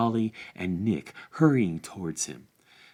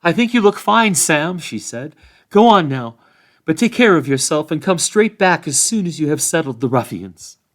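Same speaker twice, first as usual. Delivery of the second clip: Rosie, higher and louder, caring; fond young woman; 7 semitones higher, 9 dB louder than usual. A narrator's reading. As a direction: send him off with affection. Rosie sends Sam off fondly.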